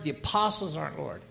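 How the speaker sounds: normal talking voice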